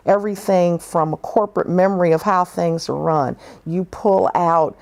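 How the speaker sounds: normal talking voice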